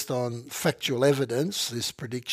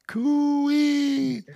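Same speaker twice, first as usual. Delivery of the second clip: long high carrying cooey call; calling in a forest; 12 semitones higher than usual. Pretend. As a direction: call it out like across the bush. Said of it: "can you say it?".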